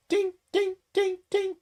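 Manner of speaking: squeaky high ding, like a bell; bell-like high ding sound. Retold or imitated. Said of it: "why I said ding".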